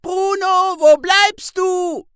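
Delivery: very high, loud, slow call from afar; a young girl calling Bruno, high and loud; bright calling voice. A narrator's reading. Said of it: "ruft eine helle Stimme".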